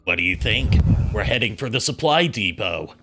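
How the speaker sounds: Snake voice. Acted. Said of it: "doing a lot of Snake voices".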